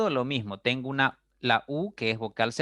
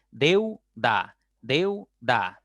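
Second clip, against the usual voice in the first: "Deuda" as deu, pause, da; syllable by syllable; 3 dB louder, much slower than usual. Shown as a demonstration. Teacher proving two syllables, "Deuda" deliberately divided. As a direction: keep deu together and set da apart in "Deuda". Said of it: "Tienen que pertenecer a una misma sílaba".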